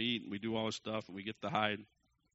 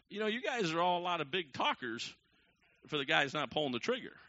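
loud, high-pitched complaint; exasperated retort; 6 semitones higher, 5 dB louder than usual. Retold or imitated. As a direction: push back at the goading, loud and high. Dad retorting to goading family.

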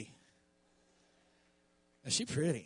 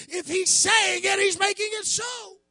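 high, raised preacher's exclamation; excited realization; 10 semitones higher, 7 dB louder than usual. Retold or imitated. Preacher voicing Moses on God's revelation, high excited exclamation. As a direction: cry it out as a sudden revelation. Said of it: "But Moses said".

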